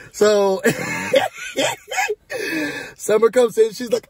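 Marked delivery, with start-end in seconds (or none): 0.6-2.9 real high laughing fit. Real, not acted. Recapper cracks up mid-story, real laughter.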